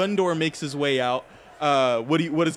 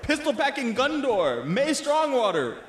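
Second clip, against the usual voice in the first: fight announcer; 7 semitones higher than usual. Acted. Announces fighters before the bout.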